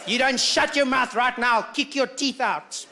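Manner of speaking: hammed-up menace, stretched toward the end; mock threat. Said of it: "Some of the best threats".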